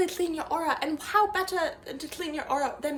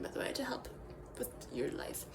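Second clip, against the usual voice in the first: whispered aside; 7 semitones lower, 12 dB quieter than usual. Acted. Wellness guru whispers a disclaimer to the viewers. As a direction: whisper the fine print to the viewers. Mock guru's throwaway aside.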